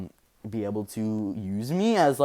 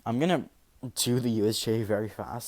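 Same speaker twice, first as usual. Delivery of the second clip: ordinary delivery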